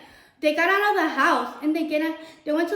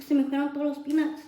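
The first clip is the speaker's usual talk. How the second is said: normal talking voice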